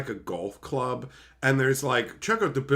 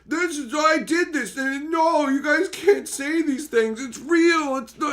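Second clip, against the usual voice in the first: very high, louder mock protest; hammed-up indignant squeal; mock upset whine; 12 semitones higher, 5 dB louder than usual. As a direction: imitate an upset person protesting in falsetto. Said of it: "getting upset and just being like".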